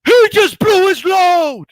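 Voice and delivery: high, loud, slow commentator call; commentator quote. Retold or imitated. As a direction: belt it out like a ringside announcer. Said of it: "he goes".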